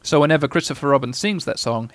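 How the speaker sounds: usual speaking voice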